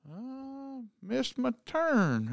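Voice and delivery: put-on high, soft, slow drunk excuse; imitated drunk man. Drunk man explains landing in the yard, imitated sheepish drunk. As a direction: draw it out small and high.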